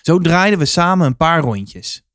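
ordinary delivery, nothing marked

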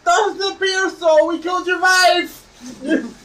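cocky hero shouting back; mocking heroic taunt. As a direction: taunt the villain with a grin. Player taunting the vampire.

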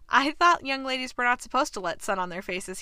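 ordinary talk

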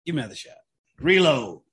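tipsy and fading. Real, not acted. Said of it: "I'm getting drunk".

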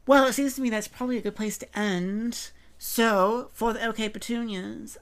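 put-on sign-off in a dubious Southern accent, stretched at the end; weird Southern accent. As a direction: put on a half-hearted Southern lilt to sign off. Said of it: "I adopted that weird Southern accent".

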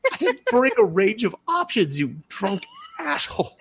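high-pitched hammed-up outrage, close to laughing; mock exasperation. Girl to the drunk man about pantyhose, exasperated. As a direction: squeal the retort in mock indignation.

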